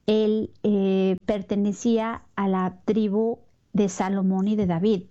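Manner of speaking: normal talking voice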